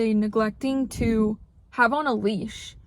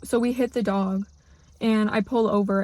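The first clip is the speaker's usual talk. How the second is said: ordinary talk, nothing marked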